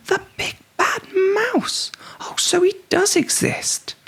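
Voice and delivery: very high breathy gasp of fright; high scared whisper-cry; frightened cry. A narrator's reading. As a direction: gasp in terror. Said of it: "child frightened".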